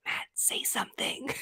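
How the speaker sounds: urgent hushed whisper; whispering to her husband to speak up. Retold or imitated. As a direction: whisper it to nudge him. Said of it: "I was like".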